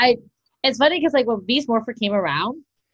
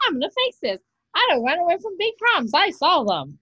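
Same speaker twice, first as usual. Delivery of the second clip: kiddish catchphrase; 7 semitones higher than usual. Retold or imitated. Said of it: "it was never like".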